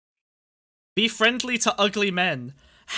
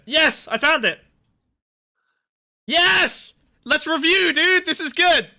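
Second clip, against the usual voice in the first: excited; 6 semitones higher, slower than usual. Real, not acted. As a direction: celebrate finding it. Finds the article, cheering.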